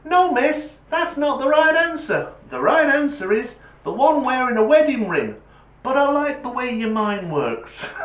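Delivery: mock the teacher's own line back at her; smug kid voice. Schoolboy correcting his teacher in a high, smug voice.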